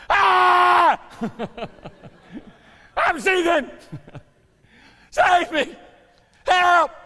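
screaming high and loud for help; desperate cry. Shown as a demonstration. Peter drowning, shrieking for the Lord to save him. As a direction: scream like you're going under. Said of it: "A cry of desperation".

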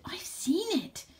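high, drawn-out excited cry. A narrator's reading. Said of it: "The elephant became very excited".

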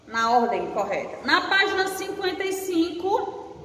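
ordinary talk, nothing marked

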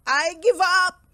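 loud, high wail of surrender; hammed-up tearful bear; blubbering. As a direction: cry out in defeat. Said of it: "he blubbered".

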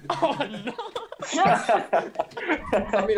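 high laughing squeal; laughing too hard to speak; breaking up with laughter at the bad accent. Real, not acted.

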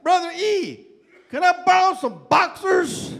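loud, high call across the yard; hammed-up yell; mock shouted request. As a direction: call it out boldly. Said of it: "she goes".

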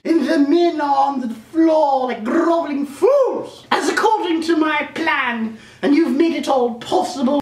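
high, slow domineering queen; evil queen voice. Pretend. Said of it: "like the queen".

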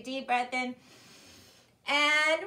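big breath then a full, loud roar; loudest lion roar. Shown as a demonstration. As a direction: roar out as loud as you can. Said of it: "let's do our loudest breath".

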